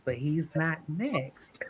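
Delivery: slow secretive whisper; teasing whisper. Real, not acted. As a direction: let them in on a secret.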